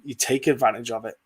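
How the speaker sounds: ordinary talk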